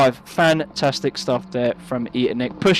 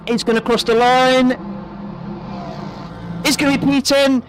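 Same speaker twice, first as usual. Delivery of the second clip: play-by-play commentary; 6 semitones higher than usual. Real, not acted. Live race commentary calling laps.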